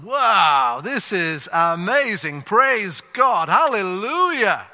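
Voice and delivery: hammed-up delighted shouting; ecstatic congregation. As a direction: gasp and praise like a thrilled crowd. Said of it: "they're all going".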